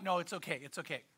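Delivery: low, hushed soothing; soft, unworried mock reassurance; retold reassurance. Owner reassures alarmed lady.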